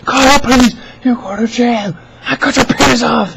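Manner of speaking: very high, hammed-up angry yelling; yelling caller. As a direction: imitate an irate caller hurling threats. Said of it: "yelling over the heads".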